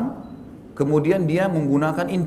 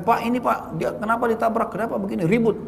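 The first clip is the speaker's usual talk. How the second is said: higher, pushy voice of a meddling stranger; agitated bystander; 7 semitones higher than usual. Retold or imitated. Preacher voicing a stranger who blames him, higher and pushy. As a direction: ask it like a busybody accusing you. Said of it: "kemudian mengatakan".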